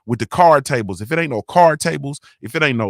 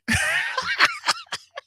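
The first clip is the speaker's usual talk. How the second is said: high, wheezy burst of laughter; 5 dB quieter than usual. Real, not acted. Man joking about auntie's fish warning, high laugh. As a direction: laugh helplessly at your own story.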